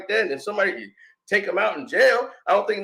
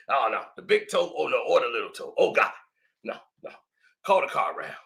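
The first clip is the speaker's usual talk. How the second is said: hushed, breathless pained moaning, much softer; mock injured groaning; 5 semitones lower, 6 dB quieter than usual. Pretending to be the injured analyst, faint pained whispers. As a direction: whimper like you can't stand on that ankle.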